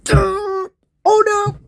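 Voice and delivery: very high, loud oh no; a high squeal of shock; shocked cry. Acted. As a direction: yelp in dismay. Both characters die.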